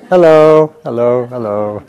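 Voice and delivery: loud, high-starting Indian vendor hello; endearing Indian accent. Retold or imitated. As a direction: greet the whole street like a cheerful Indian vendor. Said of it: "in that very endearing accent".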